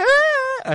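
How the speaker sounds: very high wordless squeal of glee; hammed-up high "ah" of joy; delighted squeal. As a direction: squeal as if your crush just texted. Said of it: "recibes el mensaje y tú de".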